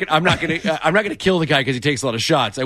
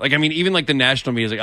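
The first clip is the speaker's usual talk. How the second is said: normal talking voice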